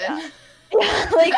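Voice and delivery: high laughing yeah. Real, not acted. Laughing reply.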